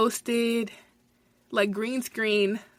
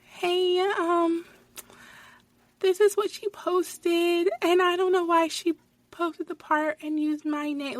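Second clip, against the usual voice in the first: put-on high, drawn-out babyish whine; mock her in a slow, high baby voice; mocking little-girl baby talk; 8 semitones higher, slower than usual. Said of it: "with a baby voice".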